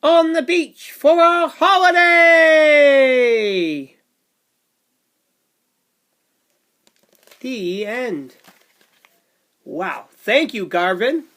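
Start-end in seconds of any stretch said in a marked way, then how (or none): 0.0-2.7 high loud happy call; big happy holiday shout for the kids; excited finale. Acted. 7.4-8.4 put-on lively read-aloud for little kids; sing-song storybook rhythm; bouncy picture book narration; reading to children.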